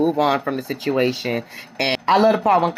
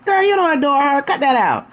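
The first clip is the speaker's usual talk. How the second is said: calling her out; 6 semitones higher than usual. Retold or imitated. Calling out a false claim.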